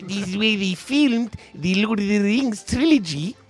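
hammed-up New Zealand accent; bad New Zealand accent. Host introducing a guest from New Zealand, bad Kiwi accent. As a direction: badly fake a Kiwi accent. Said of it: "That's such a bad accent".

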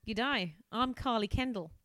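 hammed-up Australian greeting; horrendous Australian. Carly introducing herself, in a horrendous Australian accent. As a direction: put on a bad Aussie accent. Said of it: "Horrendous Australian".

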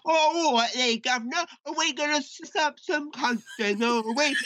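high, hammed-up mock Cockney accent; Charlie Hunnam's mock Cockney. Charlie Hunnam at his audition, over-the-top Cockney accent. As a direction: ham up a fake London Cockney geezer. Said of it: "can't tell a British accent".

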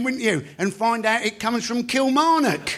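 ordinary talk

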